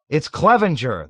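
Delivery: loud, high shout. A narrator's reading. Soldiers shouting as their rescuer appears, loud. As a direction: shout with glee. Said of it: "they shouted".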